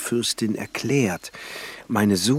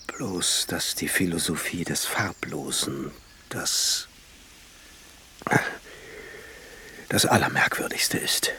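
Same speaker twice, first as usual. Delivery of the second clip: whisper; 3 semitones lower, 4 dB quieter than usual. Acted. Alchemist's muttered aside.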